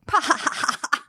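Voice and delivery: high, scornful burst of fake laughter; high-pitched "pa-ha-ha" guffaw; mocking laugh imitation. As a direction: imitate someone laughing in your face. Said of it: "He laughed".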